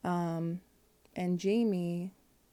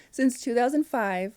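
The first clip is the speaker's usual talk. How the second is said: laughing; 5 semitones higher, 7 dB louder than usual. Real, not acted.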